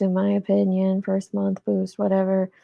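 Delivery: ordinary delivery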